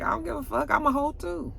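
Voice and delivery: mimicking the trucker. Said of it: "And he was like".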